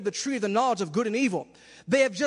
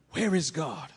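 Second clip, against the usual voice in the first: hushed question; 8 semitones lower, 5 dB quieter than usual. Acted. Hushed aside after reading.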